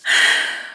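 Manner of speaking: heavy, breathy exhale; big huff of breath; exasperated sigh. Real, not acted. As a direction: let out a long frustrated sigh. Venting before a silent scream.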